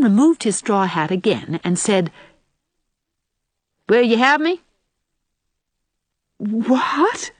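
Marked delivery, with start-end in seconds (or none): 3.9-4.6 lightly voiced rustic suitor, higher pitch; country suitor. A narrator's reading. Sam proposes out of the blue to Anne, bluntly. 6.4-7.3 astonished, higher, drawn-out question; gasped. A narrator's reading. Anne hears Sam's sudden proposal, gasping. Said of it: "gasped Anne".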